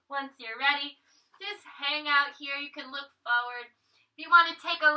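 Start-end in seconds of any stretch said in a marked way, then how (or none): none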